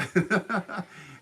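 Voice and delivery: loud, high burst of laughter; real laugh. Real, not acted. Storyteller cracking up at own story, laughing.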